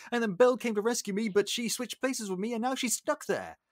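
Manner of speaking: high-pitched, hurried account; acted flustered father; Maurice. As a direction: pour out the story anxiously. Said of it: "okay, Maurice, what happened?".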